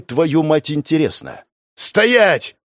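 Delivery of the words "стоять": sharp loud order from behind; stop-right-there shout; shouted command. Acted. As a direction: bark it like a guard. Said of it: "услышал я голос сзади".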